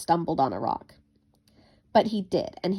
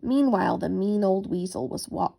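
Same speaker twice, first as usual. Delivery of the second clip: ordinary delivery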